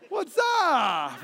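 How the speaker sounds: high, drawn-out holler; imitated clueless greeting. Hard-of-hearing man answering unseen caller, mock holler. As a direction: call it out, falling at the end.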